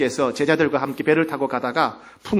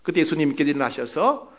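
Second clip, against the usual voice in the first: ordinary delivery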